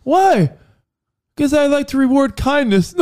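high, light prankster voice; high voiced prankster. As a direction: play the kind prankster. Voicing Walmart prank exchange.

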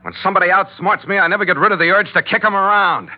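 a bad loser promising to kill them. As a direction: spit out a loser's vow of revenge. Kearney threatens his captors.

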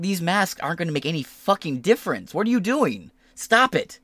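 a hint of an angry scold; high mock outrage. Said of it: "he was like".

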